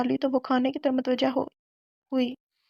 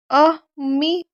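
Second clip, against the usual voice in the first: cry of pain; 2 semitones higher, 5 dB louder, slower than usual. Acted. Girl falls down oiled stairs.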